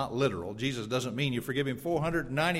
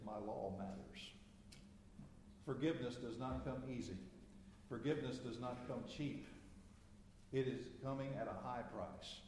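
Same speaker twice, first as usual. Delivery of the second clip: much softer, intimate preaching; soft, solemn sermon voice; hushed sermon; 15 dB quieter than usual. Acted. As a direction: draw them in with a hush.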